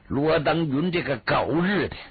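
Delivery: ordinary delivery, nothing marked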